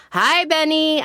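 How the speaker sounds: high-pitched greeting call; mild shout. A narrator's reading. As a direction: call up to someone overhead. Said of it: "I shout".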